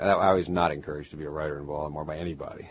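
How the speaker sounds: ordinary delivery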